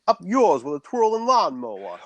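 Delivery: put-on quoting in a corny Italian accent; fake Italian accent. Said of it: "fake Italian accent".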